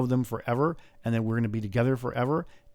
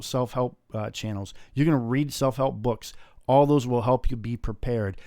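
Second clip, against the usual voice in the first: ordinary talk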